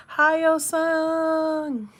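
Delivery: high, loud teasing greeting to a troll; squeaky hyped-up hi yo son; comments voice. Pretend. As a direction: call out to the troll in a big mock voice. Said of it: "talking to them in the comments voice".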